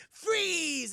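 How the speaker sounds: put-on high, loud kid's command; playful shout. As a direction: call it out like a boy playing hero. Boy calling out in game.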